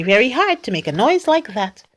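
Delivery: ordinary delivery, nothing marked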